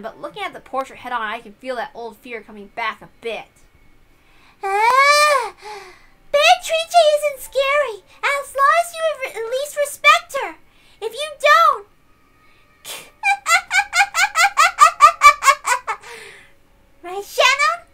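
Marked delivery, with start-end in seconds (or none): 4.6-11.9 put-on high, slow, loud little girl warning; a child cheerfully hinting at the witch's wrath; creepy sing-song child; eerie child voice. Child warns about the witch. 12.8-16.4 put-on high, loud, drawn-out childish laugh; a little girl cackling at a dark secret; creepy sing-song laughter; eerie child giggle. Child laughs after the warning. 17.0-17.9 put-on a little girl seeking the maid's confirmation; creepy childish question; eerie child voice. Child seeks agreement.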